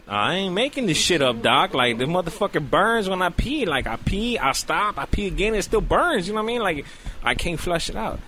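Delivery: retold indignant rant at the doctor; indignant complaint. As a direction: insist hotly to the doctor.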